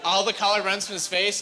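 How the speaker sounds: usual speaking voice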